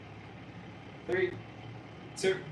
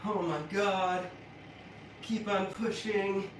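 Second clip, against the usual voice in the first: doing sit-ups; 4 semitones higher, 6 dB quieter than usual. Real, not acted. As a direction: talk through the burn of ab work. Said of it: "My abs are on fire".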